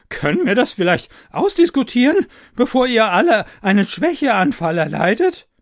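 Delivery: high, loud pleading voice; appealing taster. Acted. Taster pleads with the mob, high and loud. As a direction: plead for reason. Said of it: "appellierte an die Vernunft".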